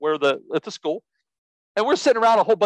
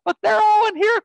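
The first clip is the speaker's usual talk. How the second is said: mock exasperated shout; 14 semitones higher than usual. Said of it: "I'm thinking".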